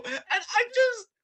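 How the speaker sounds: high, loud cry-laugh; laughing helplessly. Real, not acted. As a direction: squeal through laughter.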